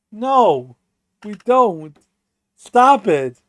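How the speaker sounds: ordinary talk, nothing marked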